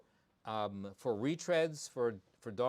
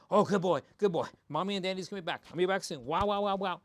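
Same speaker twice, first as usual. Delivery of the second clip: mock gushing goodbye, louder and higher; hyped-up owner imitation; 5 semitones higher, 6 dB louder, much faster than usual. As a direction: ham up a dog owner overexciting the dog. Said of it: "you say".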